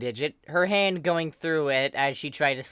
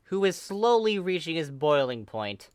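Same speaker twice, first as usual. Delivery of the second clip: ordinary delivery, nothing marked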